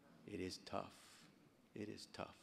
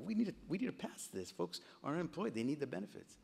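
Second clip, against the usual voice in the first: urgent appeal; 7 semitones higher than usual. Retold or imitated. Retells urging colleagues to pass bill.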